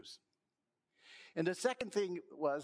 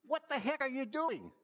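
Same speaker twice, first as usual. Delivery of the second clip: mock yell; 8 semitones higher than usual. Squirrel yelling up at the climbing elephant, high mock yell. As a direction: call up at the elephant in a startled yell. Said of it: "he yells out to the elephant".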